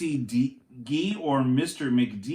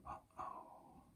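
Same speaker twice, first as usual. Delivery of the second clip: whisper; 29 dB quieter than usual. Acted. Teacher builds suspense for kids.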